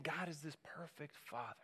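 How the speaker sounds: soft and intimate, barely above a murmur; hushed. Acted. Preacher voicing Jesus to the congregation, hushed. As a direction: say it gently, as a secret truth.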